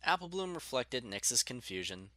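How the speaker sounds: plain reading voice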